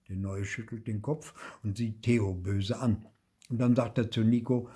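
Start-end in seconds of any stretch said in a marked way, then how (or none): none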